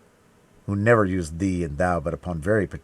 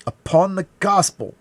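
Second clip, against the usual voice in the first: high, loud vow; fervent oath; 12 semitones higher, 9 dB louder than usual. A narrator's reading. D'Artagnan to the dying man, high fervent oath. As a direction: swear it with desperate force.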